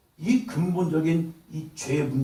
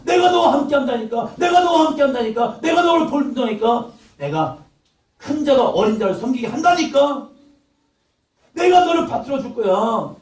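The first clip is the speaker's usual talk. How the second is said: high, loud, insistent promise from God; loud divine reassurance; God's booming voice; 9 semitones higher, 6 dB louder than usual. Acted. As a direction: boom it out as God reassuring Jacob. God reassuring Jacob, preacher voicing.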